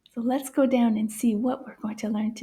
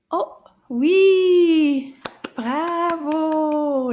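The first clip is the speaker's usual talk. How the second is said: high, loud, drawn-out cheer; excited praise; 4 semitones higher, 7 dB louder, much slower than usual. Pretend. Teacher praising children for finding the sound, excited. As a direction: cheer the right answer.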